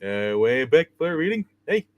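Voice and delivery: lightly voiced goofy cartoon sidekick; a loose cartoon sidekick voice; Barney Rubble voice. Host shows his Yogi voice sounding like Barney Rubble. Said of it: "I make him sound like Barney".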